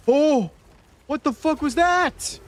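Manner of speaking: high-pitched, real shock; startled outburst. Real, not acted. Streamer hit out of nowhere in the game, startled. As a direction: yelp it out, pitch shooting way up.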